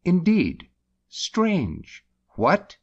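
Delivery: high surprised exclamations; wondering. Shown as a demonstration. Teaching wonder, a high surprised indeed. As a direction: sound amazed. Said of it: "wonder, as".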